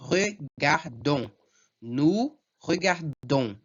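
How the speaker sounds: slow regardons, weighted on a nasal final don; stress on last syllable. Shown as a demonstration. Teacher models nous form for students, slow regardons with strong last syllable. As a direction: pace out regardons, landing on don. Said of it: "Sempre a última".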